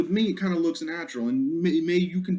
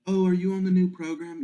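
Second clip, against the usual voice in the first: higher-pitched curious asker; chatty acquaintance; 6 semitones higher than usual. Acted. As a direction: ask it high, like a chatty acquaintance. Voices someone in imagined conversation.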